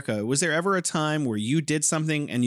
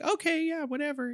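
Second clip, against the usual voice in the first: high, then trailing much softer; imitating a mom brushing it off; dismissive mom; 13 semitones higher, 4 dB quieter than usual. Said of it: "she'd just be like".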